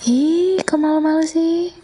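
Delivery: high teasing coo; playful teasing. Acted.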